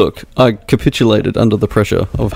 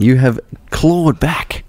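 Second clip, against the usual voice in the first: dramatic whisper; 3 semitones higher, much slower than usual. Acted. Host congratulating dramatically.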